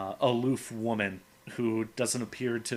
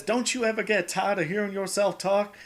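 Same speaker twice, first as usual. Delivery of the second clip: higher-pitched, argumentative Southern comic-book heroine; Rogue; 10 semitones higher, 4 dB louder than usual. Retold or imitated. Rogue arguing with Gambit in the car, imitated fed-up voice. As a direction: put on her high, fed-up voice. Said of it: "he and Rogue are getting into an argument".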